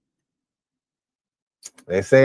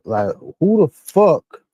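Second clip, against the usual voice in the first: outraged disbelief; 9 semitones higher, 6 dB louder, slower than usual. Real, not acted. Outraged at the killer.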